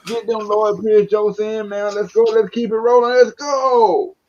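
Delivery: high-pitched rallying call; hyped up. Real, not acted. Host kicking off the Lloyd Pierce jokes, hyped up. Hyping up the coach jokes segment.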